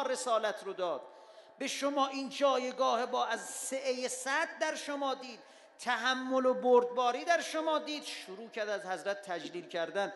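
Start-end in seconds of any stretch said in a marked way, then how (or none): none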